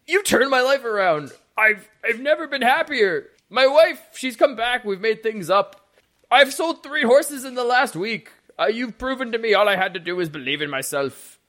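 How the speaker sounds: high, loud, gushing stable man; hammed-up joyful hysteria; manic, tearfully grateful man. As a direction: gush it like a man whose life was saved. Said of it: "his manic episode then resumes".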